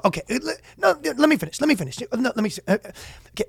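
high, fast, interrupting debater voice; Patrick McDavid. Retold or imitated. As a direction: cut them off and launch an anecdote. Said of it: "My Patrick McDavid is".

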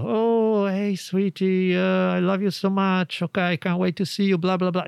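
imitating gushing talk on the phone to Savannah; affectionate sweet talk. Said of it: "He's like".

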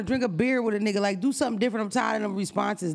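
usual speaking voice

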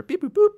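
high, bright beep-boop droid chirps. Retold or imitated. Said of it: "R two D two".

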